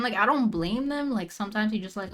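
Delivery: normal talking voice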